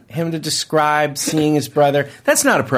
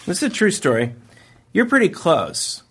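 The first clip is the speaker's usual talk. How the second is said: ordinary talk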